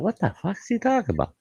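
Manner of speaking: quick, high, hushed disbelief; baffled. Retold or imitated. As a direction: act out a puzzled thought, fast and high. Retold baffled thought about visitor.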